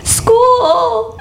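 very high, drawn-out mock wail; hammed-up tearful squeak; mock bawling. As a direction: imitate blubbering through happy tears. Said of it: "I just started bawling my eyes out".

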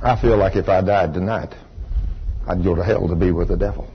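put-on low, hushed retelling of a child's words; quiet confession. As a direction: voice a child's grave admission softly. Retold small son's grave confession.

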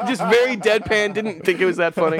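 high laughing narration; laughing while talking. Real, not acted. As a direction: talk through the giggles.

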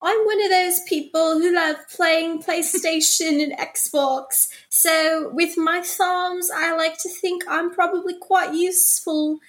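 put-on British accent. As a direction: do Prince Harry in a British accent. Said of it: "let me do my British accent".

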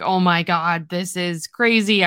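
normal talking voice

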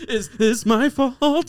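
high, slow and loud innocence; mock innocent. Real, not acted. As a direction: play innocent. Mock-innocent after laughter.

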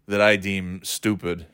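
ordinary talk, nothing marked

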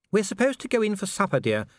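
a hint of a refined lady; Sybil voice. A narrator's reading. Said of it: "said Sybil".

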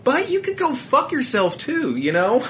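very high, falsetto-like comic voice; mock-sweet insult. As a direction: leap way up high for a playful dig. Comic insult after praising [name].

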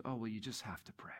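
soft whispered reminder; wife whispering advice while he washes dishes, whispered. Retold or imitated. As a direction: hush it like a wife murmuring at the sink. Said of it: "She goes".

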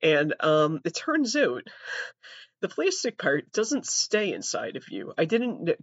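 ordinary talk

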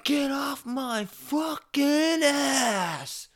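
high, loud, slow mock yell from a road-rage driver; imitating a yell. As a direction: bark it like the angry guy at the stop sign. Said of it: "yells at me".

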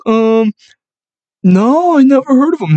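high, loud mock dumb girl voice; dumbest voice. Coworker girl at store answering him, in a high, loud, dumb mock voice. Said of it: "The dumbest fucking voice".

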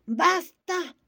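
high, loud shriek of rage; furious shriek. A narrator's reading. Gigi cutting off the pushy lady, shrieking. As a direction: scream him down. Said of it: "chilló Gigi iracundo".

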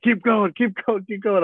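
very high mock scream, chanted urging; imitated screaming. Guest imitates the speakers blaring behind an interview, mock screaming.

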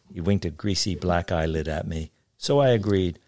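usual speaking voice

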